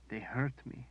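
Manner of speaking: soft tearful high voice; weeping softly. A narrator's reading. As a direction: weep it quietly. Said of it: "she wept".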